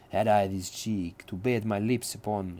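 put-on Italian accent, smoothly seductive; suave Italian accent. Shown as a demonstration. Lecturer imagines Iachimo wooing Imogen, suave Italian accent. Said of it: "a really kind of suave Italian accent".

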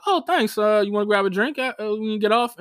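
high, bright eager flirting; light flirty self.

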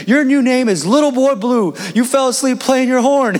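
high, emphatic announcement; delighted proclaiming. Retold or imitated. Coach renaming the freshman, delighted proclaiming. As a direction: imitate a coach declaring a verdict. Coach bestows nickname, retold.

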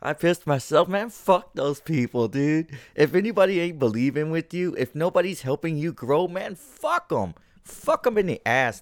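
real heated outburst; heated rant. Real, not acted. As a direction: vent at full heat. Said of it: "I'm actually heated".

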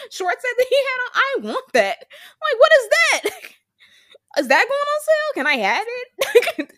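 high, loud, eager pleading; excited gushing. Real, not acted. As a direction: beg to buy it, thrilled. Gushing over an outfit.

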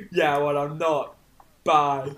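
lightly put-on shopkeeper voice; a slow, slightly altered character voice; hint of a suspicious merchant. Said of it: "my voice".

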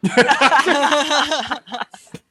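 high eerie giggling; childish giggle. Acted. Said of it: "keine Antwort außer Kichern".

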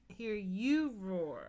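drawn-out slow "Hear you roar"; slow cueing delivery, "you" stressed; slow demonstration. Shown as a demonstration. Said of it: "You were supposed to say".

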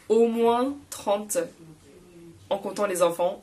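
ordinary talk

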